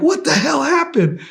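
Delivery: high, loud annoyed question; angry demand. Retold or imitated. Woken team demands what happened, high, loud and annoyed. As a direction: snap at him for answers.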